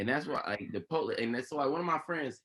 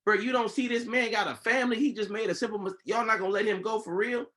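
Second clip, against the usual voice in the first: higher, pleading disbelief at police; 6 semitones higher than usual. Retold or imitated. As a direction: push back in disbelief. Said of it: "I'm like".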